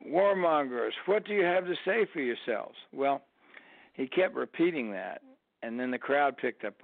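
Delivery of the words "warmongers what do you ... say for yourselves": higher-pitched mock heckle; mock protester's call; retold bullhorn yelling. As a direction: imitate a man yelling through a bullhorn, not at full volume. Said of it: "was yelling".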